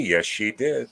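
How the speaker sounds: usual speaking voice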